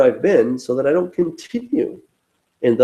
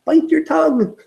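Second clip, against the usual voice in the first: high-pitched mock scolding of himself; self-scolding; 12 semitones higher than usual. Telling himself not to type a nasty reply, mock scolding. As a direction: rein yourself in, in a high put-on voice. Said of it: "and I'm like".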